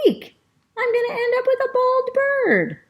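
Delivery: very high squealing panic; Rosa's shriek. Retold or imitated. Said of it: "She was like".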